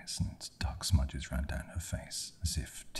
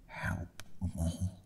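strangled, higher rasp; rasped plea; 4 semitones higher, 4 dB quieter than usual. A narrator's reading. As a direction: force it out hoarsely through a tight throat. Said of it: "The girl rasped again".

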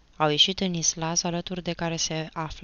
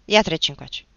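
commanding; 4 semitones higher, 8 dB louder than usual. A narrator's reading. Achim orders his brother over.

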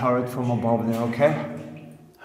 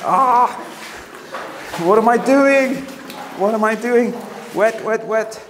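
excited nervous shout; 7 semitones higher than usual. Real, not acted. Nervous on the wet ladder.

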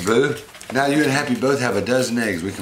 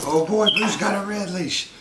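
put-on excited baby talk; 11 semitones higher than usual.

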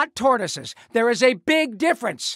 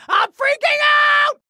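high, loud, drawn-out panicked scream; full-blown panic; 6 dB louder than usual. Acted. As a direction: scream it out, stretching the last word. Third, peak of panic.

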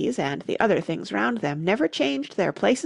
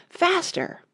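high, urgent cry to hurry; 10 semitones higher than usual. A narrator's reading. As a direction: cry it out high, urging a runner on. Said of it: "still the Queen cried".

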